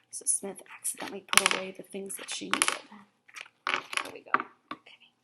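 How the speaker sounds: quick whispered aside to herself. Real, not acted. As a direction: sussurro rápido para si mesma. Aside while cutting.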